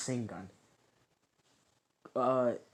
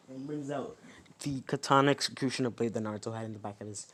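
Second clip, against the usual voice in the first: plain reading voice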